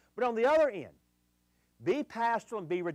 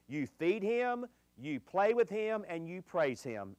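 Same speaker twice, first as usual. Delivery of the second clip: slow list; slower than usual. Acted. Listing the three dog needs.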